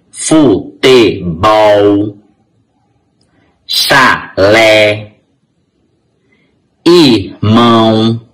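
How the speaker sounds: "boné", "armazém", "paletó" slowly split, final syllable held; stress on last syllable. Shown as a demonstration. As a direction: break up words like "futebol" and land on the last syllable. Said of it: "todas as últimas sílabas são as sílabas fortes". (palavras como "chalé" pausadas, última sílaba forte)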